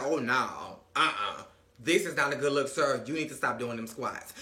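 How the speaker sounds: deep disgusted [name]. Retold or imitated. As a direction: sink the voice low and turn it down. [name] refusing squats.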